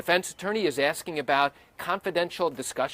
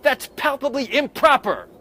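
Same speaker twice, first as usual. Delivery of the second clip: loud, higher courtroom objection; yelled objection; 9 semitones higher, 7 dB louder than usual. Retold or imitated. Attorney jumping up to object, loud yelled objection. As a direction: shout it at the judge. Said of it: "yell out".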